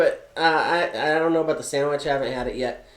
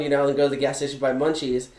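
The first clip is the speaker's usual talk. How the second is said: ordinary talk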